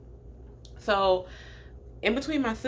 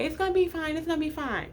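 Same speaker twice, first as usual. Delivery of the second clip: light joking reassurance pitched much higher; teasing comfort; 9 semitones higher, faster than usual. Retold or imitated. Family jokes to calm crying Tiana, high teasing reassurance. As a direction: tease her kindly, up high. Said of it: "They're making jokes about it".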